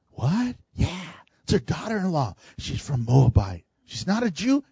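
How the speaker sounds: soft, shocked gossiping; hushed gossip. Retold or imitated. Townsfolk trading gossip about Ruth, hushed. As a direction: murmur it like a scandalised neighbour.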